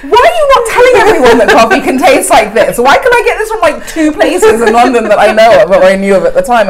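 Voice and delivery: high, loud, laughing indignation; mock offended. To the Melbourne baristas, playfully outraged, high and loud. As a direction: demand to know why nobody told you. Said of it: "I was like".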